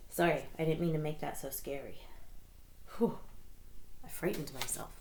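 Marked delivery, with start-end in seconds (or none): none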